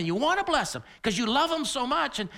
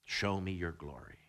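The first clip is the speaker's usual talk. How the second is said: hushed plea; 14 semitones lower, 10 dB quieter than usual. Retold or imitated. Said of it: "Then Moses said".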